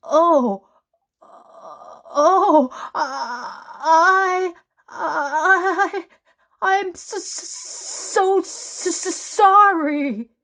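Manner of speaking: very high, stuttering, flustered apology; squeaky, stammering sorry; nervous stammer. A narrator's reading. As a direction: trip over every word in panic. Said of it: "stammered Mister Good nervously".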